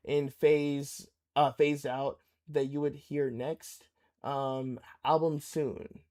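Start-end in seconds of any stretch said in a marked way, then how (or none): none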